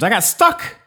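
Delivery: high, loud burst of I got stuck; sudden high exclamation; exclaimed. Real, not acted. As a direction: blurt it out like a punchline. Dramatic exclaim of being stuck.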